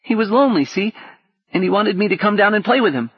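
put-on high, quick little boy voice; child voice. A narrator's reading. Small boy Gally telling Paul about his dream, high child voice. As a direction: chatter eagerly like a small child.

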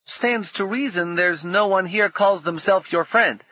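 put-on high boy forcing calm; lightly shaky-brave boy; boy keeping steady. A narrator's reading. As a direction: hold it steady though scared. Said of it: "fighting to keep his voice steady".

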